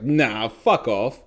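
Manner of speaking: slow, stretched mock disbelief; drawn-out disbelief. Staff doubting his confession, slow drawn-out disbelief. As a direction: drag it out like you can't believe it.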